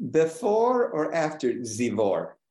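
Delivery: slow, imitated German accent. Expert witness answering the defense lawyer, in an imitated German accent. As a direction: mimic a German expert, drawing the words out. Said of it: "he happened to be German".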